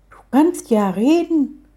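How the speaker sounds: high, drawn-out amazement; wide-eyed wonder, stretched out; astonished. A narrator's reading. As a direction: marvel slowly, pitch leaping up. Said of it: "sagte Samira staunend".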